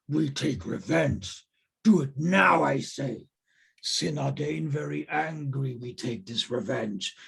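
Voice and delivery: slow, broken-English ogre voice; growing indignant, drawn-out ogre speech. Acted. Game master voices ogres plotting revenge, slow ogre voice.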